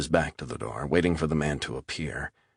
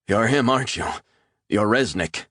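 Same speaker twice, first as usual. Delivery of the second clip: higher, louder dawning alarm; startled recognition; 6 semitones higher, 4 dB louder than usual. Acted. Captive recognising Resnick, higher and alarmed. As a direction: realise who he is, alarmed.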